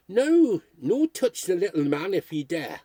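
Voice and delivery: a Geordie fishwife crying out to warn the mob off. Retold or imitated.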